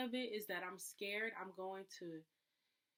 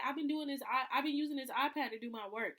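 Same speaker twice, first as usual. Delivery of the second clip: higher, louder complaining; indignant protest; 6 semitones higher, 8 dB louder than usual. Pretend. Person whose borrowed iPad is taken back, higher louder complaint. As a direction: complain that your work is being blocked.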